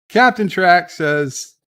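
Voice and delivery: ordinary delivery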